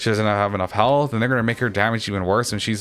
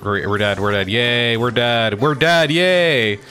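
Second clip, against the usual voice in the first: sarcastic cheer; 3 dB louder, slower than usual. Real, not acted. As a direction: cheer your own defeat, rising each time. Mock cheering own death, building.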